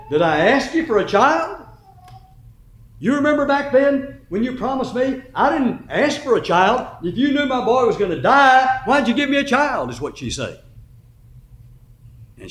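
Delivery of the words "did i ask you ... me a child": higher, louder reproach of a grieving mother; mother reproaching the prophet for her son's death, raised voice; chewing him out. Retold or imitated. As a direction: scold him with bitter grief. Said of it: "she just kinda chews him out".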